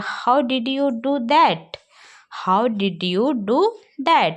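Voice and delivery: drilled phrase; slow teaching model. Shown as a demonstration. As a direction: model the question for students. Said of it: "ये आपने यह कैसे किया".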